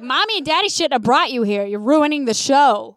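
loud mock scolding of children; retold pissed-off comic. Comic telling kids they're ruining the show, mock angry. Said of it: "he was pissed at the kids".

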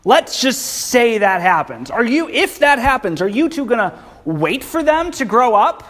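high, incredulous mocking retelling; sarcastic paraphrase. Naomi ridiculing the idea of waiting, sarcastic. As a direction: play it as a scoffing modern rant. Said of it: "Just pure sarcasm here".

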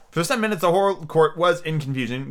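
usual speaking voice

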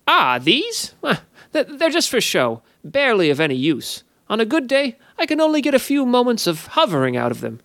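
high, mock-glum sprite voice, fast at the end; a sly fey faking sadness; despondent sprite. A narrator's reading. The sprite claims his wings are useless, mock despondent. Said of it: "he said despondently".

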